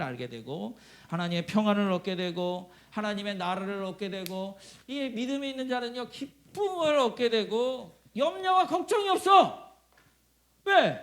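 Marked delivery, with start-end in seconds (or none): 4.9-11.0 rousing pulpit crescendo; building preaching climax. Real, not acted. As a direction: grow higher and louder to a peak.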